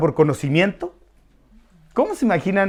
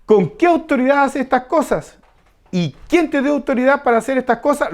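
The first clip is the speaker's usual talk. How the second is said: pitched higher throughout, a confrontational reproach; demanding, accusing tone; 8 semitones higher than usual. Shown as a demonstration. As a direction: ask it like leaders confronting an intruder. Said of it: "esta pregunta es así". (los sacerdotes reclamando a Jesús, en tono acusador)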